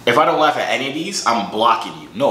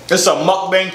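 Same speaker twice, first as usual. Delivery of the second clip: higher, louder hype voice; hyped announcer; 7 semitones higher, 4 dB louder than usual. Pretend. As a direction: sell it like a show host. Repeats word in hyped voice.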